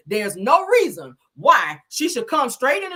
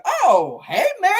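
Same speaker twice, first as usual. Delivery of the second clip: knowing hey; 9 semitones higher, 4 dB louder, slower than usual. Retold or imitated. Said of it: "it was one of those types of hey".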